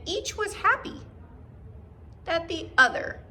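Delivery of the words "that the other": usual speaking voice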